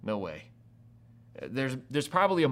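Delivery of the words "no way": dismissive. Acted. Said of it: "you'd be like".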